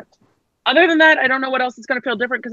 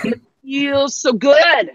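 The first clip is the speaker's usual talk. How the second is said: drawn-out, higher-pitched gush; gushing delight; 6 semitones higher, much slower than usual. Acted. Teasing her about buying the Max, mock gushing. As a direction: imitate a smitten buyer, savoring it slowly. Voicing a delighted phone buyer.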